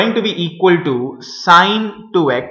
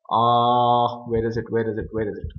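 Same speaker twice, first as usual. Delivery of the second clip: low, very quiet mumbling while searching; muttering to self; 6 semitones lower, 8 dB quieter than usual. Real, not acted. Teacher hunting for a formula in the notes, muttering to self. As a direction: say it under your breath, pitched down.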